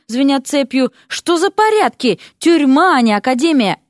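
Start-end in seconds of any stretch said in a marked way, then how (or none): 1.1-3.8 loud, high-pitched outburst; indignant complaint, voice raised. A narrator's reading. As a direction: protest in outrage. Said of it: "возмутился Дерек".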